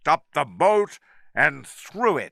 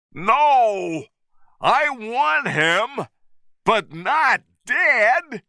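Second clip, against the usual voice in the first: shouted; 11 semitones higher, slower than usual. A narrator's reading. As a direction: yell it to stop the shooting. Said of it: "Carl shouted".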